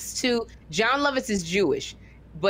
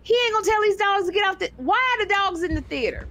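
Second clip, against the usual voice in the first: incredulous outburst; 10 semitones higher, faster than usual. Retold or imitated. Comedian on stage watching dogs roam, shouting in disbelief. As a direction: blurt it out in shock at the dogs. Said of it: "I'm literally sitting there going".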